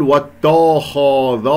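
"واتخذ" with every vowel darkened to "aw"; all letters heavy. Shown as a demonstration. Teacher showing what not to say, whole of "واتخذ" made heavy. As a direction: thicken ت, خ and ذ alike in "واتخذ". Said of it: "لا أفخم هذه الكلمة كلها".

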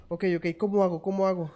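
loud flustered question; loud panicked. Real, not acted. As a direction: panic and ask loudly. Panicking in game.

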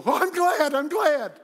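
high, loud show of gladness; hammed-up delighted cry; mock cheer. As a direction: cheer it like a prompted crowd. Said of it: "Y'all go".

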